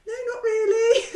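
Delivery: high, loud, laughing fake cry; mock wail. Frankie answering polite woman, mock wail. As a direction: wail it as a hammed-up upset child. Acts daughter wailing after bad show.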